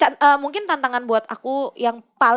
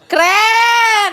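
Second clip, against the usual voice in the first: mock cry; 10 semitones higher, 8 dB louder than usual. Mock praise, fake crying.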